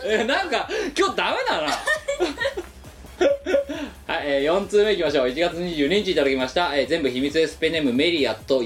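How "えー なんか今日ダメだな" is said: laughing. Real, not acted.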